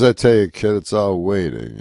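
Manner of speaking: lightly imitated veteran comic, loud attack; Rodney Dangerfield. Older comic confiding in a kid, lightly imitated, loud. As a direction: start loud, then ease off. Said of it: "he goes".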